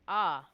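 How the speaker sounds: deadpan little cry amid imagined screaming; understated "ah". Pretend. Imagining the calm Druid amid screaming, understated "ah". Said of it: "your character goes".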